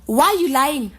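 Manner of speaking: scolding teacher raising voice; loud accusation. Acted. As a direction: call out the lie. Teacher confronting pupil.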